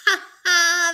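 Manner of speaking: high, loud gleeful laugh; hare laughing. A narrator's reading. The hare looking back at the tortoise, laughing high and loud. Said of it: "He looked back and laughed".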